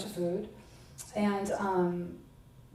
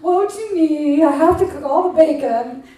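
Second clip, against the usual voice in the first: hammed-up long-suffering sigh of a martyr, louder; 5 semitones higher, 7 dB louder than usual. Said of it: "I didn't say it like that".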